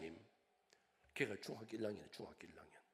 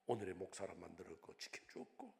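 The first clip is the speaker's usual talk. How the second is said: ordinary talk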